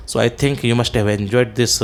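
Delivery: ordinary talk, nothing marked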